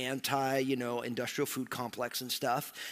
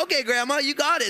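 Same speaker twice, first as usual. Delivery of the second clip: high, bright and louder, all in; eager agreement; 11 semitones higher, 6 dB louder than usual. Retold or imitated. Grandson eagerly accepts grandma's cooking lessons, excited. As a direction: jump at the offer.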